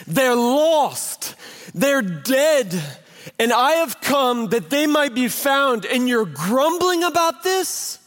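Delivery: high, emphatic confrontation of the Pharisees; impassioned rebuke. Retold or imitated.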